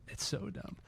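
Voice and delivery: breathy whispered aside. Real, not acted. Scoffing at a pricey vinyl record, whispered.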